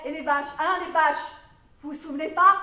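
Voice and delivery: loud imitation of pestering interrogator; badgering questioner. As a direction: mimic someone pressing insistently. Acts out the questioner pressing her.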